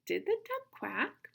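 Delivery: high-pitched "Did the duck", rising "quack"; asking a question. Shown as a demonstration. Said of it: "they're asking a question".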